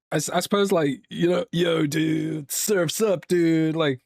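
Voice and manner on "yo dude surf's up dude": higher-pitched American surfer-dude accent; hammed-up American surfer talk; exaggerated American accent. Shown as a demonstration. British host demonstrating American accents, exaggerated surfer dude. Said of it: "the really exaggerated kind of ones".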